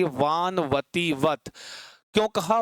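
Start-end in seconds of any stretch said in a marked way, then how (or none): 0.0-1.4 slow drilled phrase. Shown as a demonstration. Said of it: "तुरंत दिमाग में आ जाना चाहिए".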